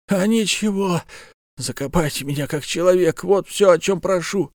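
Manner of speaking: pained, strained bandit voice; wounded bandit. Acted. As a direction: speak through fresh wounds. Dying bandit's last plea.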